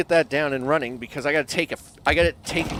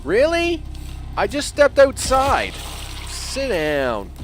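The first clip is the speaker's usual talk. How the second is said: exasperated; 10 semitones higher, slower than usual. Real, not acted. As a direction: throw up your hands in high-pitched disbelief. Exasperated at attack.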